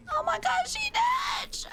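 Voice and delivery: "Oh my gosh, she dead" shrieked very high, then a whisper; shrieking squeal. Acted.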